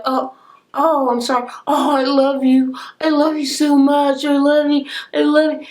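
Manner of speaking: high-pitched mocking whimper; mock crying. Recapper mimicking a wife's tearful apologies, mock crying. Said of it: "the crying".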